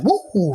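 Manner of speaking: high, drawn-out "woo"; whoop of excitement. Acted. Man imagining loving his life, excited high whoop. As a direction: let out a high mock-joyful whoop. Acted joy at waking up.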